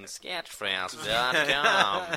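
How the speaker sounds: slow, evenly spaced robot announcer. Pretend. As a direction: drag each word out like a machine. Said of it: "a robot voice throughout going".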